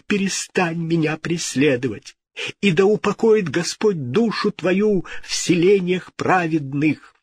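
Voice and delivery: high-pitched anxious entreaty; pleading king. A narrator's reading. King begging a ghost to leave, imploring.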